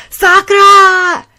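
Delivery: loud, high, drawn-out cry of her name; anguished call. Acted. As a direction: scream her name as she falls through. Calling her as she plunges.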